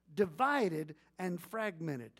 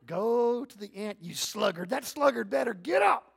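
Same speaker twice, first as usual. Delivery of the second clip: loud mock scold of a sluggard; scolding self-talk; 7 dB louder than usual. Preacher voicing harsh self-talk at a lazy man, loud mock scold. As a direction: bark it at yourself like a drill sergeant.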